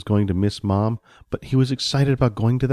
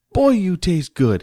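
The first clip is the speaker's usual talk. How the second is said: put-on higher, drawn-out child delight; savouring little boy; 8 semitones higher, 4 dB louder than usual. Johnny eats Jelly Boy's arm, savouring it. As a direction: relish it slowly in a child's voice.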